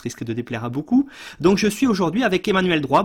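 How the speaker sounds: ordinary talk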